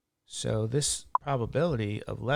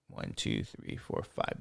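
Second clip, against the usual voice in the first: counting under the breath, much softer; whispered count; 9 dB quieter than usual. Real, not acted. Teacher recounting zeros for himself, whispered. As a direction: checks the count quietly to himself.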